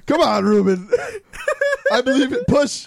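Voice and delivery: high, loud cheering through laughter; mock birth coach, laughing hard. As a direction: coach him like a midwife while cracking up.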